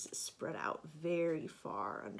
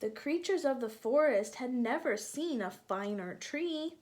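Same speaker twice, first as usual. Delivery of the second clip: bright, lilting storybook narration, a bit louder; reading to children; 3 semitones higher, 6 dB louder than usual. A narrator's reading. Teacher reading a picture book to children, lilting storybook voice. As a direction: draw the little ones into the story.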